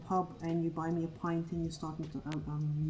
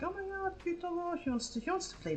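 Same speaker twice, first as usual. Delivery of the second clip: much higher, gushing dog voice; excited puppy voice; 12 semitones higher than usual. Retold or imitated. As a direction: squeal like an overexcited dog. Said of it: "Scotty goes like".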